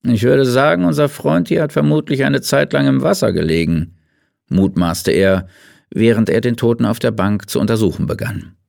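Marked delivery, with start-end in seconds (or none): 0.0-4.0 a slightly higher, thoughtful doctor's voice; a musing pathologist, unhurried in places; a lightly voiced doctor pondering the worm. A narrator's reading. Kleinhus voiced.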